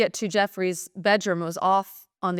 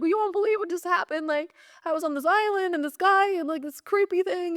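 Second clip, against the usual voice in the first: much higher, breathless rush of disbelief; urgent gushing; 12 semitones higher than usual. Retold or imitated. Telling the clients what just happened, a much higher, urgent rush. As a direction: blurt it out like a shaken girl bursting to tell.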